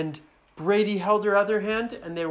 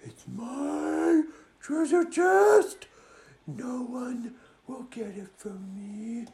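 put-on possessive girl dragon; 7 semitones higher, slower than usual. Dragon guards treasure chest, high shout fading to menace. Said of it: "She said".

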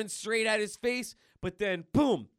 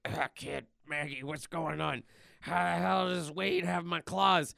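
put-on weary tough-guy voice; gruff Wolverine. Groggy Wolverine voiced on table.

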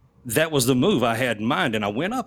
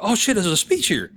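excited discovery; 8 semitones higher than usual. Retold or imitated. Finding speech at podium.

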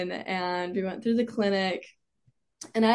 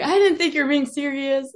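imitated dad in higher, louder disbelief; dad freaking out; 6 semitones higher, 6 dB louder than usual. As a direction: quote a freaked-out dad, voice raised. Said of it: "he was freaking out".

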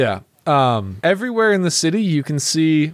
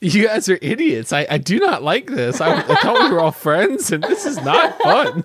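hammed-up British accent, wounded protest; Seamus; 3 semitones higher than usual. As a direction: play the offended Brit, climbing higher at the end. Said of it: "making fun of my accent".

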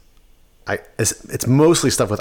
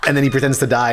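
ordinary talk, nothing marked

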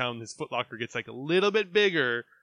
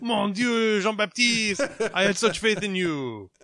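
put-on loud, high French accent lament; French chef; 7 semitones higher, 6 dB louder, slower than usual. Mock French dismay.

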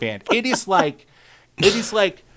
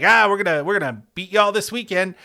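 higher, louder taunting fan voice; mock a smug fan's trash talk; mock Tennessee fan; 6 semitones higher, 3 dB louder than usual. Host mimicking a Tennessee fan's taunt, higher and louder. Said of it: "They'll be like".

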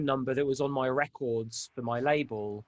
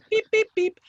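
very high, loud beeps; imitated sampler beeps; 7 dB louder than usual. Producer imitating a sampler, high loud beeps. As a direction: squeak out electronic beeps.

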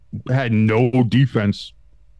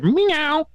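mock cat meow. Cardiac cats cheer.

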